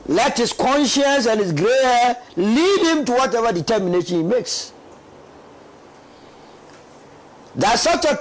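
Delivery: loud, high, passionate outcry; rising to an emotional peak; impassioned. Real, not acted. Panelist grows impassioned about tragedy.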